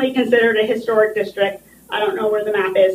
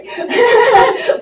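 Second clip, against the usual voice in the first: nervous laugh; 13 semitones higher, 3 dB louder than usual. Real, not acted. Emotional plea to the commission.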